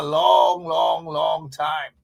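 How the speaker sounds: long, long time stretched slowly; slow, emphatic promise to stay; drawn out. Real, not acted. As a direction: savour each long.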